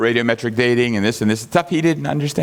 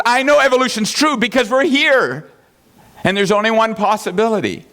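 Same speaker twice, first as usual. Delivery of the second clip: mock confident scientist; 9 semitones higher than usual. Imitating Suzuki's statement.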